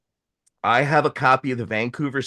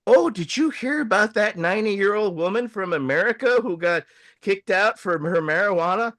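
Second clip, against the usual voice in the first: higher singsong gossip, lightly mimicked; gossiping older woman; 7 semitones higher than usual. Older women chatting in the border line, higher gossipy voice. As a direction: imitate an older woman eagerly passing on news. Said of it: "these two older women".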